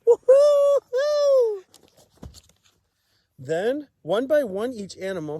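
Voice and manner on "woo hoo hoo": very high, loud slow woo-hoo; joyful whoop. Acted. Gerald celebrating his dance, loud high whoop. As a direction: whoop for sheer joy.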